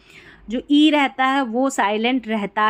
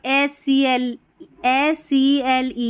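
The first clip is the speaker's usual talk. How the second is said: slow spelling; much slower than usual. Shown as a demonstration. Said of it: "spelling out letters".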